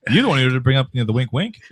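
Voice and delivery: ordinary talk, nothing marked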